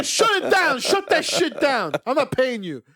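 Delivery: high, loud shouted order with laughter; mock shout. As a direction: yell at the scammer to shut it down. Yelling back at phone scammer.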